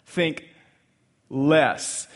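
ordinary delivery

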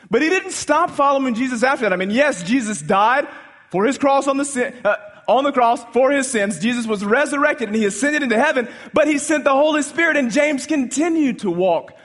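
high, loud preaching build; full-force preaching. Acted. Pastor rousing the congregation about James, in full-force preaching. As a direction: preach it out to the back pew.

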